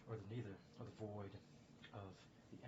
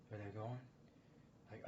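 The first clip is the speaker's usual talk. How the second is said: ordinary talk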